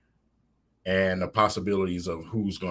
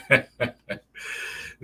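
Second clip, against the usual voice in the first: laugh; 5 semitones higher than usual. Real, not acted. Laughing at own joke.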